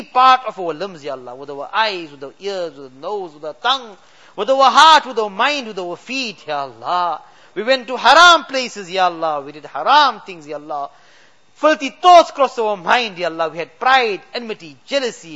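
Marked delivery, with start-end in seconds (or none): none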